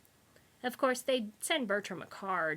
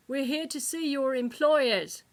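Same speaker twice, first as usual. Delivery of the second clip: higher, loud call over the din; shouting back; 5 semitones higher, 7 dB louder than usual. A narrator's reading. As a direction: shout it back across the room. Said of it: "the kidnapper shouted back".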